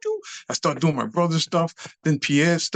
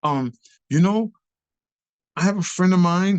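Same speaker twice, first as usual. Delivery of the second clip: higher, lightly acted casual proposal; Frank; 6 semitones higher, 4 dB louder than usual. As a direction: ease hesitantly into an offer. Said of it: "he gets into this whole normal conversation".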